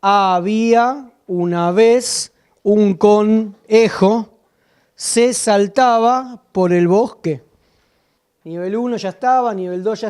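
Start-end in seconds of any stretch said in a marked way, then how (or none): 0.0-7.4 put-on child's text read slowly. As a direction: read "Había una vez un conejo" word by word, very slowly. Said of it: "Miren, este pibe dis-, tiene".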